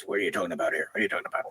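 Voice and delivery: low, hushed puzzled voice; mock puzzled rumble; deep, quiet imitation. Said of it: "And he goes".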